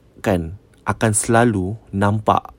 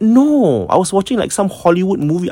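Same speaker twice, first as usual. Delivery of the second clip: imitating the sister; 8 semitones higher than usual. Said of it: "She cakap".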